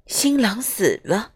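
plain reading voice

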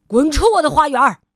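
loud fast angry yell; furious shout. Acted. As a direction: drive him off the lawn. Grumpy man yells at visitor.

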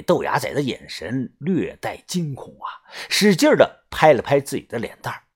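ordinary delivery

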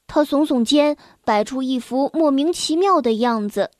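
usual speaking voice

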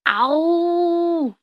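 high, long drawn-out roar; dinosaur roar. Acted. 霸王龙示范吼叫. (恐龙长长地吼叫)

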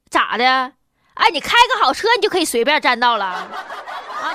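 put-on sassy northeastern Chinese accent; mock a cocky northeastern driver. Mocking rich driver's attitude.

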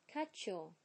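"Cut your" merged into "cutchyour"; linked cut your. Shown as a demonstration. Teacher shows how "Cut your" becomes chew. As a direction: blend t and y into ch. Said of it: "变成了chew".

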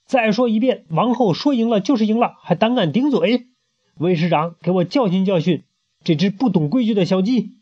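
high, haughty scolding; queen orders the guard to punish; indignant queen. Acted. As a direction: scold the impudent chick.

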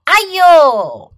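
loud yelp of pain; yelling. A narrator's reading. Fox after baton hits his toe, yelling. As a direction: cry out over a hurt toe. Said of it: "他喊道".

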